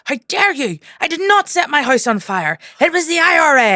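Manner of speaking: high and loud, building into a laugh; outraged drunk heckler. Retold or imitated. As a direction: rant it, cracking up at the end. Heckler ranting at comedian.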